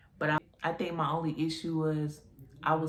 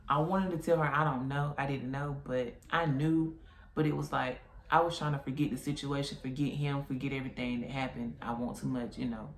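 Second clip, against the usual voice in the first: ordinary delivery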